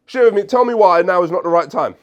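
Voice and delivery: loud, high, pushy demand; forceful demand. Shown as a demonstration. Coach showing the wrong, combative way to ask. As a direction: bark it like an order. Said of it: "You can't just say".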